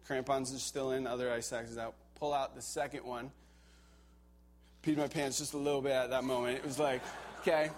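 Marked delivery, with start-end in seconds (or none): none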